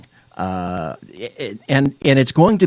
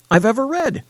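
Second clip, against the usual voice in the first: normal talking voice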